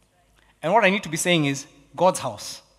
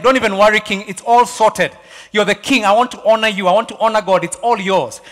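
loud, raised-pitch insistence; insistent generous offer; 5 semitones higher, 8 dB louder than usual. Acted. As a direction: press the gift on the king, loudly. Acts Araunah insisting to the king.

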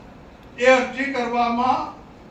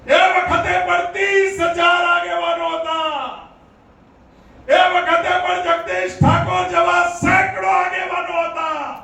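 raised, ringing orator's voice; fiery rally speech; 5 semitones higher than usual. Acted. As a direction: rouse the crowd with every phrase. Rally oratory at full force. (જોશીલું ભાષણ, ઊંચા અવાજે)

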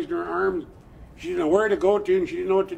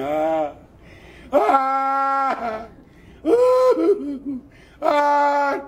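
high sobbing wails that climb; crying; 7 semitones higher, 4 dB louder, much slower than usual. Acted. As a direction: cry out, pitch jumping up. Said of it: "she started crying again".

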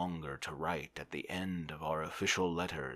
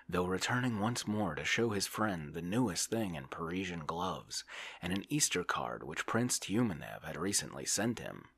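plain reading voice

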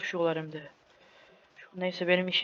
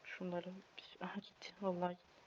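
whispering; 18 dB quieter than usual. Real, not acted. Tense hiding moment.

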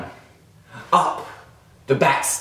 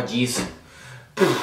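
ordinary talk, nothing marked

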